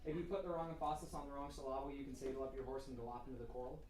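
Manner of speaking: quick patter with "emphasis" as em-FAH-sis and "syllable" as sil-LAH-ble; wrong syllable stress. Shown as a demonstration. Teacher showing students misplaced emphasis, stresses deliberately wrong. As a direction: rattle it off misstressed: "saddle" as say-DOLL, "gallop" as guh-LOP, "coral" as CORE-ul. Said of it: "Have I told you guys about the emphasis?".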